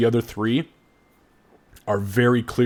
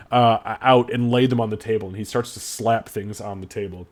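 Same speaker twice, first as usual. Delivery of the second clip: normal talking voice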